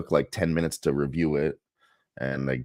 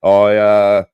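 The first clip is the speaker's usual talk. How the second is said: Kennedy clone voice; 6 semitones higher, 8 dB louder than usual. Retold or imitated. To his friend, quoting the cartoon clone, loud Boston impression. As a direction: ham up the Kennedy Boston accent. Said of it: "his voice is just hilarious on that show".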